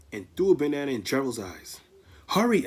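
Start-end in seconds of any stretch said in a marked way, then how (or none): 2.3-2.7 higher, louder urgent call; cry out. Acted. Ellie crying out to friends to flee, loud and high. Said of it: "Ellie cried".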